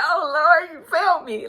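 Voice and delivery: high, loud wail of a prayer through tears; retelling her crying at the altar, a hammed-up tearful wail; mock crying prayer. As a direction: pretend to sob out a thank-you to God. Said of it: "But I was really crying".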